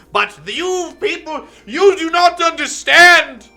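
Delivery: high, loud, slow theatrical villain rant; mustache twirling villain. Acted. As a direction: proclaim like a mustache-twirling fop. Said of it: "mustache twirling type voice".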